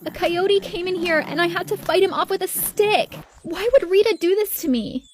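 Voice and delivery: high-pitched upset wail; distressed crying sister. A narrator's reading. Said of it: "disturbed and crying. She said".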